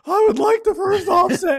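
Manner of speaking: high, squeaky parody of stage nerves; cracking stage voice. Pretend. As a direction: squeak it out as a hopelessly nervous speaker. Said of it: "Yeah, that would be me".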